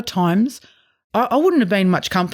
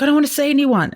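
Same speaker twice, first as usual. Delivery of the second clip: higher, whiny protest; reluctant refusal; 7 semitones higher, faster than usual. Retold or imitated. Wife refusing to go out with husband, higher whiny protest. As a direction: beg to be left alone.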